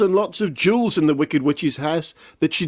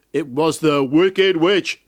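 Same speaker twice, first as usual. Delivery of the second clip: plain reading voice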